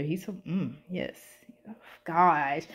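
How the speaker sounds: breathy swooning whisper of oh gosh; flustered whisper over a handsome actor. Real, not acted. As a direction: whisper it like you're flustered by a crush.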